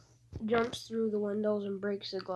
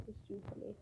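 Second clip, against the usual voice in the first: quiet; 15 dB quieter, much faster than usual. Real, not acted.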